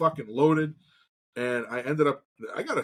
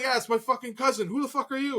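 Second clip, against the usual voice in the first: higher, mock-angry challenge; higher mock outrage; indignant retort; 10 semitones higher than usual. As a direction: demand to know who he is. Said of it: "I'm like".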